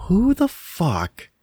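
slow, drawn-out, high disbelief; incredulous. Retold or imitated. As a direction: stretch it out in stunned disbelief. Incredulous inner voice retold.

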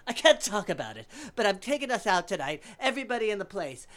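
put-on flamboyant old man. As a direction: play the showy big spender. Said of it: "so extravagant and flamboyant".

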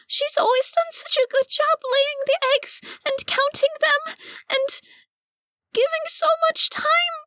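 very high, squeaky creature voice near tears; tearful duck lady. Acted. Cecily explaining herself to the party in tears, very high and squeaky. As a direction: squeak it out high, choked with longing.

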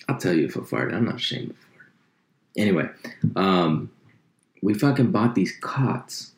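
ordinary talk

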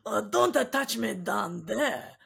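camp it up, French creeping in; mock sexy accent. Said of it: "what accent that is".

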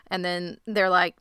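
ordinary talk

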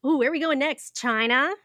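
ordinary talk

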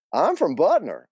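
a hint of the chatty aunt; Aunt Hallie. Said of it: "do my best Aunt Hallie and Linda".

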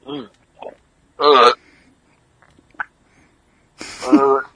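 gagging and groaning; strained groans; choking. Real, not acted. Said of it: "You all right?".

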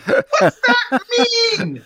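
high, squealed incredulous question; baffled high-pitched protest; mock disbelief. Mock outrage at movie line.

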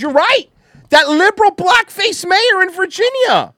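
high, loud sarcastic agreement; mock agreement. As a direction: agree with them in an over-the-top mocking tone.

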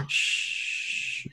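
a quick whispered shh; hushed shh. Retold or imitated. Dad hushing himself after the milk mix-up, whispered. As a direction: hush it under your breath. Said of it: "And I'm instantly like".

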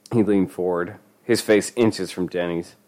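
ordinary delivery, nothing marked

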